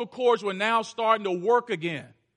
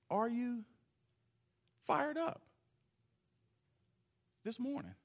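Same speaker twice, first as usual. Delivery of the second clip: much softer, drawn out with pauses; slow quiet question; 16 dB quieter than usual. Acted. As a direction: ask it slowly and gently, pausing between words. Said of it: "but not like I used to do it".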